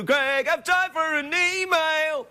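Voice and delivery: high, slow put-on voice; drawn-out goofy reply; silly sidekick voice.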